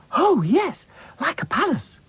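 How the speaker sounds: high, delighted princess voice; exclaiming. Acted. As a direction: gush about a palace. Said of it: "exclaimed Princess".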